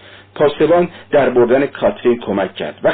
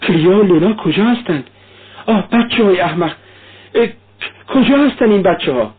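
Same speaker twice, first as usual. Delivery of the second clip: high, alarmed questioning; acted anxious search for children; sudden anxiety; 8 semitones higher than usual. A narrator's reading. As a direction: ask in sudden worry, pitch jumping up. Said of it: "با اضطرابی ناگهانی پرسید".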